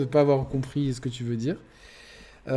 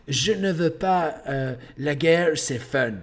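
lightly mocked English accent, slow; a Brit mangling French, taken slowly; slower than usual. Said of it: "Et des Anglais qui parlent comme ça". (léger accent anglais moqueur, lent)

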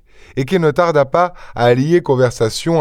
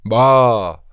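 tag exclaimed dismissal. A narrator's reading. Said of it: "finalement s'écria".

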